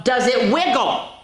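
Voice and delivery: put-on high, bright child's question; small excited voice asking a guess; high little voice. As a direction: ask it like an eager little donkey.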